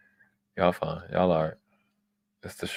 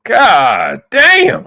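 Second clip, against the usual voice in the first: loud exclamation; 11 dB louder, much slower than usual. Real, not acted. Reacting to her comeback.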